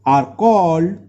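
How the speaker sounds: slow, word by word, for students to write; slow dictation. Shown as a demonstration. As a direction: dictate each word slowly. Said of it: "तो यहाँ लिख लो". (धीरे-धीरे लिखवाते हुए)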